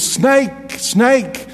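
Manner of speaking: a hint of a frantic kid at the door; excited little boy. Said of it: "all he could say in his broken English was".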